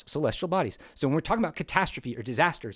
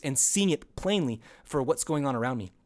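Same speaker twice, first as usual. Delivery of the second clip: ordinary delivery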